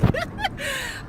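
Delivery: gleeful high-pitched giggle; high squeal of a laugh. Real, not acted. Vlogger arriving home in the country, squealing laugh. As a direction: let out a delighted squealing laugh.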